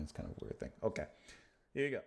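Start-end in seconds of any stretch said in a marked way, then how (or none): 0.0-0.7 whispered aside. Real, not acted. Muttered aside to himself.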